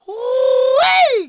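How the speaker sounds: high drawn-out holler; admiring whoop. Acted. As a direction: whoop at the flashy outfit. Admiring new clothes.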